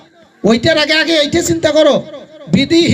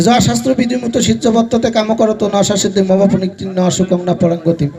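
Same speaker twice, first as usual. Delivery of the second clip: chanted shloka, a little lower and steady; Sanskrit recitation; 5 semitones lower than usual. Acted. Preacher to the gathering, reciting Gita shloka. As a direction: intone the verse like scripture.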